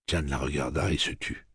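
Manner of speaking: ordinary delivery, nothing marked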